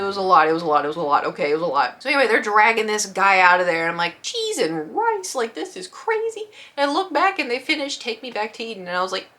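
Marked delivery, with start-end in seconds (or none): 4.2-6.4 high, softer, drawn-out exclamation; pitched-up quiet astonishment; mock disbelief. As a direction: exclaim under the din, incredulous.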